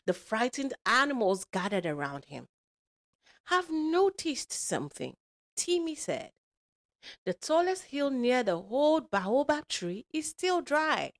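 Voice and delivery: put-on animated, lilting storyteller for kids; lively story read to children; children's storytelling. A narrator's reading. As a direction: read it aloud with big rises and falls. Tells a children's story with character voices.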